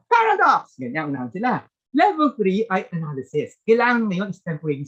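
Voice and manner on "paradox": shouted answer, pitch jumping way up; eager quiz answer. Pretend. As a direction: blurt it out high and loud like a quick contestant. Acting a student's buzzer answer. (masiglang sigaw ng sagot sa paligsahan)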